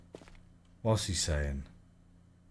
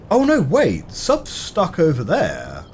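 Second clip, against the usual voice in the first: sudden realization; 5 semitones higher than usual. Real, not acted. Realizes sub is stuck.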